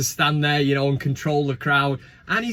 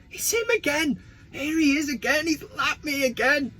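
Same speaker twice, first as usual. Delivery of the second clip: higher-pitched mock dismay; exasperated retelling; 9 semitones higher, 3 dB quieter than usual. Runner retelling being lapped at the charity run, exasperated. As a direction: voice your own disbelief at being overtaken, pitched up.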